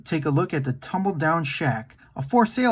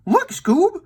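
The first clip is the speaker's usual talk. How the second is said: much higher, louder excited call; excited exclaim; 13 semitones higher, 4 dB louder than usual. Acted. Shaggy excitedly calls Scooby to the buried chest, high exclaim. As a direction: call Scooby over in delight. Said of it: "he exclaimed".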